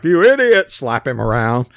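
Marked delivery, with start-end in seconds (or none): 0.0-0.7 louder and higher mock insult; a touch of mock fury; mock angry yell. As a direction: snap it at the servant, half-acted. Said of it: "be like".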